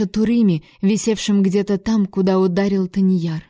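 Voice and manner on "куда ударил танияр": plain reading voice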